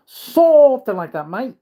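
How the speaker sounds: high, loud, slow Aussie voice; mock Australian accent. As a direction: exaggerate it like a cartoon Australian. Repeats phrase as mock Australian.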